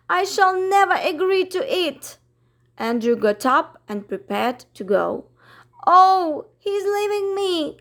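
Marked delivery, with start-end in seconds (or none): 0.1-2.2 fast, high refusal; rushed protest. Acted. Miss Lark refuses fast and high. 5.8-7.8 high, wailing dismay; distressed cry. Acted. Miss Lark sees Andrew go, high wailing cry. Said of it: "cried Miss Lark".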